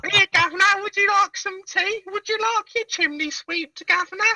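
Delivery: a high, silly put-on London Cockney servant; mock Cockney accent. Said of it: "don't apologise to me about my accent".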